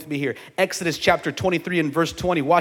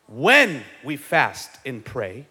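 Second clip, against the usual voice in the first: deliberate pacing with heavy weight on "when"; stress on when; 4 semitones lower, much slower than usual. Real, not acted. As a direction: draw out "when" and punch it. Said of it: "emphasis on when".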